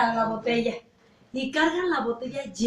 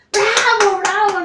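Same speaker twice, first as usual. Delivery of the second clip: loud high mock cheer; hammed-up bravo shout; 8 semitones higher, 8 dB louder, much slower than usual. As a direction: cheer like a drunk crowd. Drunks cheering the spider.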